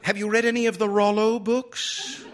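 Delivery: high, improving-minded librarian pitch; high-pitched, eager bookish lady; prim lady librarian. A narrator's reading. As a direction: recommend it primly in a high voice. Librarian figure recommending books.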